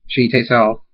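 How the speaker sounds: ordinary delivery, nothing marked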